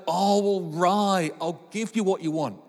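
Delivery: very slow, sighing give-in; mock reluctant giving in. Dad imitating himself caving to his kids, slow sigh. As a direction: drag it out like a worn-down dad. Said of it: "I'll be like".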